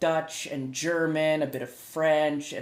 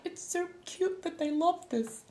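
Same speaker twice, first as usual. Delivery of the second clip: high, soft squeal of fondness; cooing delight; 12 semitones higher, 5 dB quieter than usual. Retold or imitated. Streamer watching others enjoy a hobby, high cooing. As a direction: coo it high, melting at the cuteness.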